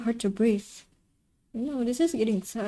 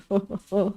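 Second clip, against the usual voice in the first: villain chuckle. Acted. Villain doctor laughs.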